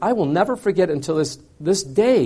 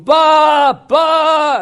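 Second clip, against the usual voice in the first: high, loud bleating baa; a ewe crying out over dead lambs; loud sheep bleat; 11 semitones higher, 5 dB louder than usual. Retold or imitated. Preacher imitating grieving ewe, loud high bleat. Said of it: "The mother's going".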